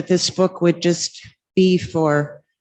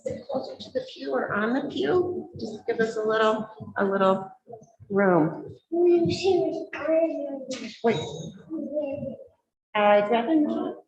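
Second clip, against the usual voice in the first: muffled behind a face mask; talking through mask; 7 semitones higher, 9 dB quieter than usual. Real, not acted. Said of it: "'cause I'm gonna take my mask off".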